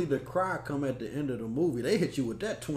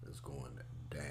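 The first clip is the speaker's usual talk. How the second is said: whispering; 11 semitones lower, 16 dB quieter than usual. Real, not acted. Whispered lines.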